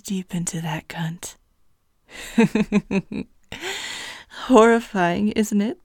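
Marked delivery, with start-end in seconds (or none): 2.1-3.2 wicked laugh. Acted. Succubus gloating over helpless victim.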